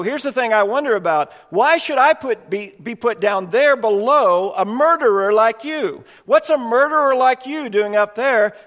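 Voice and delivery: a proud ghost protesting his rank below a murderer; indignant ghost. Acted. Ghost complains of his placement.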